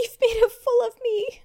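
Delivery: hammed-up wounded husband. Friend joins the story as the heartbroken costumed husband, hammed-up wail. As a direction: play the wronged husband, over the top.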